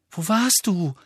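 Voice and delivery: mild alarmed worry; worried. Acted. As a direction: call out in worry as he comes in. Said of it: "fragte Hermine besorgt".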